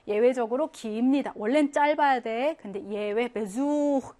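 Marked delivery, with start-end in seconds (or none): 3.3-4.2 "베수흐" with 수 drawn out; slow "베수흐", long stressed second vowel. Shown as a demonstration. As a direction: stretch the oo in "베수흐". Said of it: "길게 발음해요".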